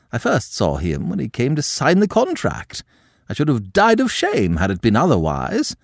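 high, loud, disdainful aristocrat; a scandalised French countess lecturing her niece; haughty old countess. A narrator's reading. Madame recalls her own marriage.

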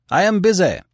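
high, loud dismissive shout. A narrator's reading. Editor sends the messenger off, shouting. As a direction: call it out sharply through the door. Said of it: "shouted the editor".

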